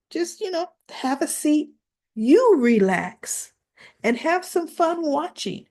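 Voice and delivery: high, lilting, gently persuasive voice; coaxing. Retold or imitated. Photographer easing the mother out of the shoot, high coaxing.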